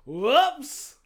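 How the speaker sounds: high, loud mock surprise; mock whoops. Retelling the lab computer, loud mock alarm. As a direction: yelp it like the screen just broke.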